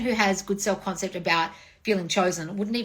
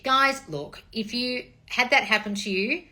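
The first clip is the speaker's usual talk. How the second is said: ordinary talk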